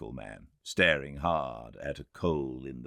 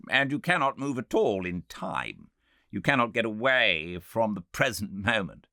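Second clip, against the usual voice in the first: raised, questioning voice of a sceptical doctor; medical man objecting; 6 semitones higher, 5 dB louder than usual. A narrator's reading. Medical man challenging the time traveller, raised sceptical voice. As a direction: press the doubt as the medical man, pitched up.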